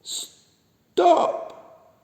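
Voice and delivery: loud, breathy high cry of stop; alarmed little duck shout. A narrator's reading. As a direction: yell it in a little duck's voice. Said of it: "shouted Little Duck".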